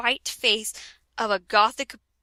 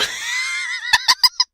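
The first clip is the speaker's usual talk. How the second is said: shrieking laugh. Real, not acted. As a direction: scream with laughter. Bursts out laughing.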